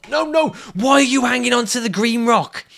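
loud, high-pitched shout; exasperated scolding. Real, not acted. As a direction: yell at the climber for grabbing wrong. Scolding the climber.

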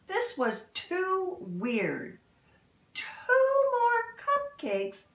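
lively storybook read-aloud; animated picture-book narration; reading to children. Acted. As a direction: read it like storytime for little kids.

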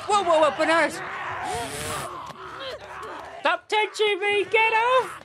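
very high, loud squealed protest; mock panicked yell. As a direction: shriek playfully as if being grabbed. Mock panic yelling at the game.

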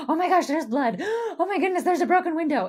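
high, loud, hammed-up panic with gasps; panicked policeman. Panicking policeman at a car crash, shrieking in hammed-up alarm. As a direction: freak out over the blood like a frantic cop. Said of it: "it's just screaming and making everything worse".

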